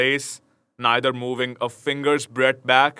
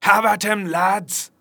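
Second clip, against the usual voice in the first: rallying cry; 7 semitones higher than usual. A narrator's reading. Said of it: "cried Will Stutely".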